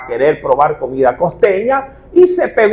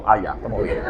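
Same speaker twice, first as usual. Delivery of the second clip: laughing resignation; 11 semitones lower, 10 dB quieter, faster than usual. Retold or imitated. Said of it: "laughs at answer".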